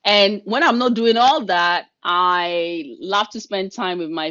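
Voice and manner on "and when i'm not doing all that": ordinary talk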